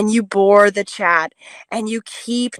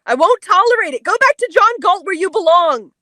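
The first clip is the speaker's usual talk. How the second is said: angry outburst; 7 semitones higher, 4 dB louder than usual. Real, not acted. Heated on-air quarrel, ordering him off.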